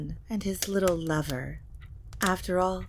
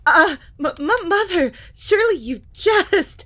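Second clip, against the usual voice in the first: high, loud stammer of panic; stammering fear; 11 semitones higher, 10 dB louder than usual. Acted. Lilibet stammering in fear to her mother. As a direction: stutter out a frightened denial. Frightened child denying to mother.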